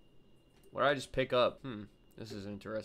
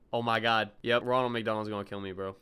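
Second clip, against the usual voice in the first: ordinary talk, nothing marked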